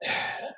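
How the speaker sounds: long, quiet sigh of resignation; exasperated sigh. Acted. Manager caught out by Olivia, exasperated sigh. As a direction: let out a weary, exasperated breath. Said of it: "he kinda does this exasperated sigh".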